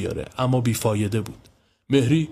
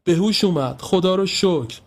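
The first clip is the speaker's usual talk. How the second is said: crying with relief; 8 semitones higher than usual. A narrator's reading.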